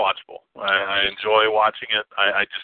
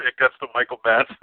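ordinary talk, nothing marked

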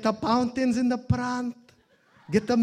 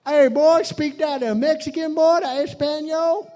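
loud Texan taunt; 7 semitones higher, 5 dB louder than usual. Retold or imitated. Texan guy calling out to the preacher, loud and high. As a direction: holler at him like a swaggering Texan.

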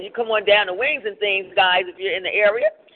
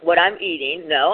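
ordinary talk

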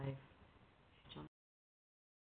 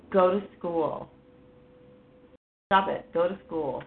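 daughter's brush-off; 8 dB louder than usual. Retold or imitated. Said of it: "And she's like".

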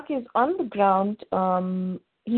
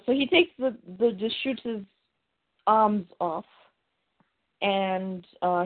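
ordinary delivery

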